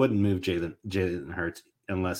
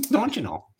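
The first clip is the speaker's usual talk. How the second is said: high-pitched mock Minnesotan accent; 12 semitones higher than usual. Co-host echoing the Minnesotan joke, high mock Minnesotan accent. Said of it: "Like, a true Minnesotian".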